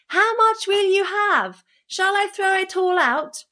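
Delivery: high, sustained holler; bawling. A narrator's reading. Tom pretends not to understand the thieves, bawling out. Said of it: "bawled out again".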